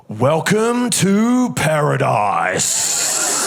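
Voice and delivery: very slow, drawn-out knowing line; sleazy welcome. Retold or imitated. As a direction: stretch every word like a smug local. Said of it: "he looks at me and he goes".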